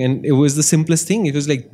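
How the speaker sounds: ordinary talk, nothing marked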